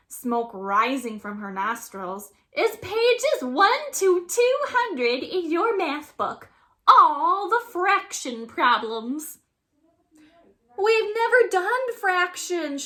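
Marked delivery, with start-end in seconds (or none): 2.5-9.4 high, loud gleeful monster teacher; grinning monster teacher. A narrator's reading. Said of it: "grins Missus Green". 10.8-12.8 high, loud protesting shout. A narrator's reading. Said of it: "shouted Derek Bloom".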